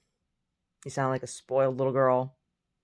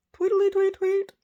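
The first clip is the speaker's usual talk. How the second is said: pilfered aside, high chirpy tweeting bird noise; bird chirp; 7 dB louder, slower than usual. Pretend. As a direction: chirp it like a little songbird. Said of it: "I'm not a bird".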